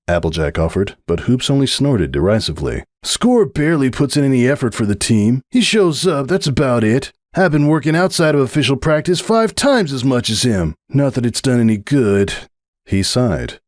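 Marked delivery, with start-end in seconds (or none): 3.0-12.3 lightly voiced stallion, resentful; a touch of sour complaint about a teammate; young stallion, bitter. Young athlete griping about his lazy teammate.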